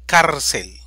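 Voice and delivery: Cárcel dicho fuerte, golpe en CÁR; ejemplo enfatizado. Shown as a demonstration. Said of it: "llanas o graves con tilde". (Cárcel, con fuerza en CÁR)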